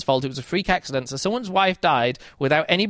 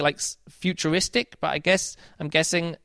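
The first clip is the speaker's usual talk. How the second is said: ordinary delivery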